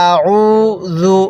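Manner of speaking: আউযু chanted very slowly; slow recitation. Shown as a demonstration. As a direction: draw out আউযু, no stretch on the হামজা. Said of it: "এরকমভাবে পড়ব". (আউযু খুব ধীরে তিলাওয়াত)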